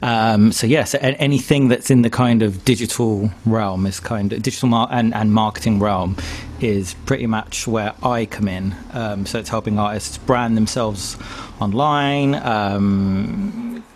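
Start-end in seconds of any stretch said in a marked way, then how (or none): none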